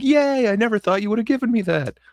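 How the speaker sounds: high-pitched mock giddy squeal of delight. Podcaster voicing Luke's possible reaction to the saber, giddy. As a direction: gush it like an overjoyed kid, voice way up. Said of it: "all giddy".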